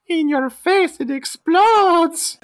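piercing falsetto, last word drawn out; Popo's parroting voice. Acted. Host as Popo echoing Fink's explanation, loud high falsetto. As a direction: squeal it very high, stretching the final word. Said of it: "And Popo is like".

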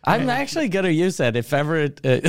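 ordinary delivery

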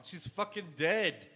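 loud, high and drawn out; incredulous protest. Real, not acted. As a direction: protest the sheer absurdity, stretching it. Protesting absurd swab on a corpse.

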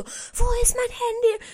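high, slow, wailing pretend panic; mock panicked whine. To viewers, the stressed guest she didn't want to be, wailing. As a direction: act out a hysterical search, drawn out and whiny. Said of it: "nicht so schlechte Stimmung verbreiten".

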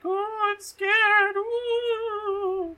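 very high, drawn-out fake fright; hammed-up wailing fear, almost sung; mock scared. Family hiding in the cellar, comically scared wail. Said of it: "the family goes to hide in the cellar".

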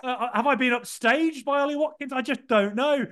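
high, giddy retelling; giddy excitement. Retold or imitated. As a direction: play up being torn between two joys. Retells his giddy reaction while proposing.

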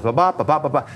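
even ba ba beats counting out ten syllables; rhythmic ba syllables. Shown as a demonstration. Teacher to class, showing the rhythm with ba beats. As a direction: tap out the beat on ba. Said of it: "Ten syllables".

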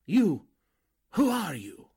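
sharp high startled "You?", then a demand; shocked disbelief. Acted. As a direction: caught off guard, voice jumping up. Exposed by hidden reporter.

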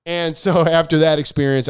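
ordinary delivery

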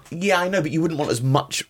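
high, hasty agreement; said very quickly. Real, not acted. Cutting in to agree with co-host, very quickly. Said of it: "very quickly there, sorry".

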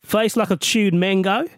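mock Australian reading; Australian accent. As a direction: list the slang in an imitation Australian accent. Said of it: "do this in an Australian accent".

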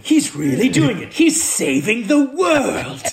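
hammed-up amazement, high and loud, laughing; mock awe. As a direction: gush in mock wonder, stretching the last words. Hammed-up quote of an awed onlooker.

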